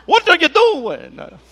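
hammed-up high, loud Italian yell; mock Italian. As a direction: cry it out slowly in a joking Italian accent. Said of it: "Like they turned Italian all of a sudden".

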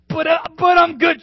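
high, loud eager protest; flustered servant. Acted. Servant insisting to the king he can pay, flustered high protest. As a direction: blurt out that you're good for it.

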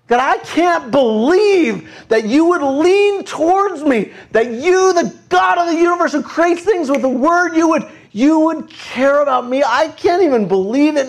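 high, stretched-out disbelief; incredulous prayer. Acted. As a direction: cry out to God in amazed disbelief. Voicing an overwhelmed believer to God.

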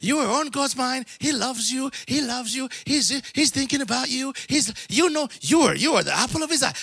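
lightly mocking a feel-good preacher; sweet Sunday sermon. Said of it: "That's what you wanna hear every Sunday".

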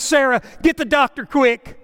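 hammed-up panic for the doctor; Barney panicking on the phone to Sarah; panicked call. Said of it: "he says".

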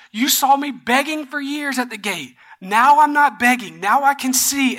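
high, emphatic pleading with doubters; exasperated protest. Retold or imitated. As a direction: act out a healed man insisting he is genuine. Healed man acted protesting to doubters.

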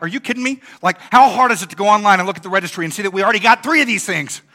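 imagined rant about the wedding registry, fuming; retold fuming rant. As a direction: vent the frustration in a mock rant. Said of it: "I'm fuming".

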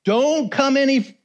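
high, urgent shout of "Stop!", slowing on "Don't come any"; retold warning cry; villagers shouting stop. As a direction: call out to halt strangers at the village edge. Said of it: "people will say".

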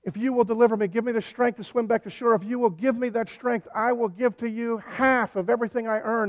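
loud, high-pitched mock bargaining with God; desperate pleading. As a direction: plead urgently, like a swimmer promising anything to survive. Acted desperate bargaining prayer while swimming.

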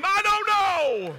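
helpless, loud I-don't-know; exasperated shout. Retold or imitated. Half-asleep father answering his frantic wife, loud and helpless.

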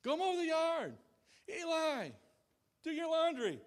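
high, loud calls to Eli; yelling up the stairs. Retold or imitated. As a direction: holler chores up the stairs. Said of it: "stand at the top of the stairs and yell".

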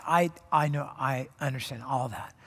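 a soft reassuring Jesus to the hurting listener; tender Jesus. Retold or imitated. Jesus voiced comforting the hurt.

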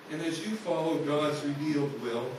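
ordinary talk

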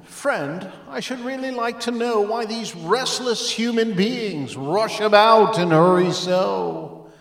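a hint of a fussy little bird; sparrow's voice. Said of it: "the little ditty".

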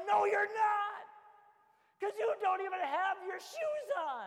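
loud, high scolding shout; exasperated parent. Retold or imitated. Dad scolding kids who have no shoes on, a loud high shout. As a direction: scold them loudly. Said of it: "I'll say".

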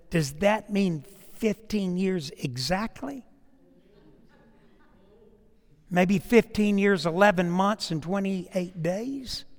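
hushed, slow, careful query; tentative imagined question. Pretend. Asking Isaiah about his fifteen years, hushed tentative question. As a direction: ask it softly, pausing between the pieces.

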